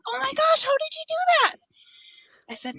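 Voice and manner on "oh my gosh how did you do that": high, astonished mom; amazed mom. Retold or imitated. Mom stunned by the chicken talk, high amazed cry. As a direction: squeal it in disbelief. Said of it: "Mom's like".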